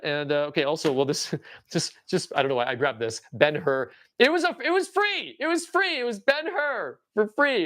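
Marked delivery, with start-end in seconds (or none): 4.2-7.6 excited. Real, not acted. Excited about free Ben-Hur.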